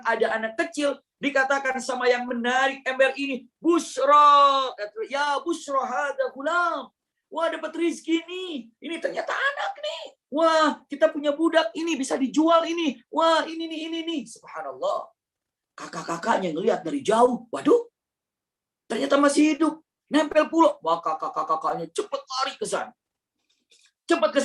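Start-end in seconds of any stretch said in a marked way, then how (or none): 3.6-14.2 much higher, laughing excited exclaim; greedy traveller delighted at found boy; excited finder. Acted. Traveller finds boy in bucket. 17.5-20.8 much higher, alarmed exclaim; shocked brothers. Acted.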